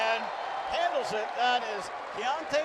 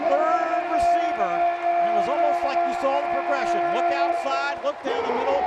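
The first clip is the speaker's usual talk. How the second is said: loud, higher excited touchdown call; excited shout; 4 semitones higher, 5 dB louder than usual. Real, not acted.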